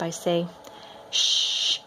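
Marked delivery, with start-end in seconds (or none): 1.1-1.8 mother whispering shh to her son at bedtime; whispered shush. Acted. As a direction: hush him to sleep in a whisper. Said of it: "Mama whispers".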